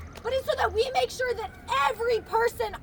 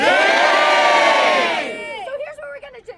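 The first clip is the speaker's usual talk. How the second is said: loud and slow to the crowd; calling out; 2 semitones higher, 7 dB louder, much slower than usual. Real, not acted. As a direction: call it across the green.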